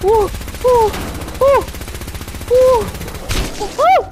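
high, loud nervous whoops; frantic whooping. Real, not acted. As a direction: whoop while fleeing in panic. Frantic escape in game.

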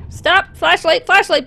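high, loud frantic pleading; panicked. Real, not acted. Player fleeing the monster, frantic loud panic. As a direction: beg it to go away in a panic.

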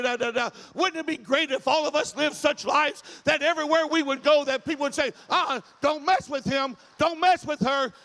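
loud, rising sermon delivery; fired-up preacher driving the point home; full-force preaching. Acted. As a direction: preach it out to the whole church, building.

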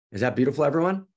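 eager host asking the room; calling out to the crowd. Real, not acted. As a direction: rouse the whole room. Calling out to the audience.

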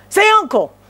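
high-pitched mock taunt; American wrestler's demand. Showing what Americans say when wrestling, high mock demand.

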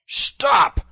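high, very loud bellow; roar. A narrator's reading. Little John under the tanner's blows, roaring. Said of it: "roared Little John".

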